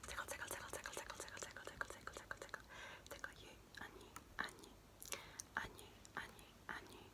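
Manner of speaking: whispered tickles dying away; fading playful whispered tickle. Pretend.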